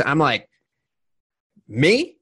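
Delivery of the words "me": high, louder startled question; can't believe they mean you; disbelieving me. Retold or imitated. Guest recalling the podcast invite, stunned disbelief.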